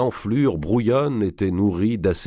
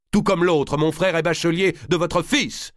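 high, louder pointed comeback; sharp retort; 12 semitones higher, 3 dB louder than usual. A narrator's reading. Valois retorts to the king, a high sharp accusation. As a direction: fire the accusation back. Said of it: "répliqua Valois".

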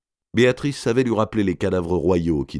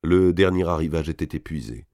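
ordinary delivery

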